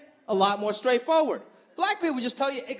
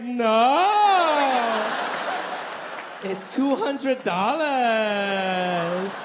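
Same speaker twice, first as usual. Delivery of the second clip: proud mom impression; much slower than usual. Mom boasting to her son about a cheap watch, in a slow put-on voice. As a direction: gleefully reveal the bargain price like mom.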